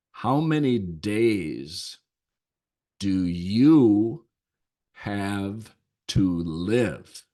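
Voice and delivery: each word drawn out with long pauses; slow it right down, weighty pauses; slow solemn question. Retold or imitated. Monk asks the ultimate question.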